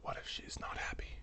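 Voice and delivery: frightened, breathless whisper; scared whisper. Retold or imitated. Mom fearing grandma's ghost, whispering in fright. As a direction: whisper it like she's afraid of a ghost. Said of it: "my mom is like".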